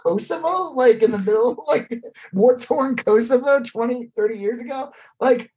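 high, disbelieving outburst; exasperated, mocking disbelief; incredulous rant. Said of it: "I'm like".

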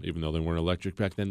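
usual speaking voice

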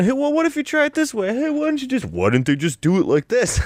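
high, mocking backseat-expert voice; mock know-it-all. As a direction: parody the helper who always knows better. Mocking know-it-all crew suggestions.

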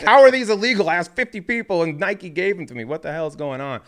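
high, loud indignant protest fading down; outraged disbelief. Retold or imitated. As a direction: squawk it out in disbelief. Indignant disbelief at illegal shoes.

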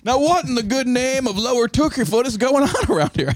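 high, lightly put-on small-town lawman; a bit of a mock sheriff laying down the law. Host playing the town sheriff, lightly hammed. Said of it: "I still can't do it".

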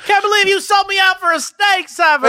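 high hammed-up betrayed heroine; mock Trinity.

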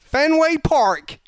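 high, loud, slow Boston practice phrase; drawn-out, hammed-up Boston; Boston accent. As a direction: belt it out in a mock Boston accent. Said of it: "That's how you just practice".

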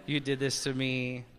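hushed, low-pitched pretend tenderness; mock loving. Preacher demonstrating talk to an upset spouse, softly mock-loving. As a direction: play at gently telling a spouse you're hurt. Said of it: "You talk to them lovingly".

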